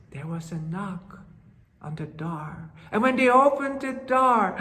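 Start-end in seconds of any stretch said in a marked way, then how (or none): 0.1-2.7 gasp, then a hushed breathy there was; suspenseful whisper. A narrator's reading. Storyteller building suspense before the third knock, whispering. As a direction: hold your breath and whisper it.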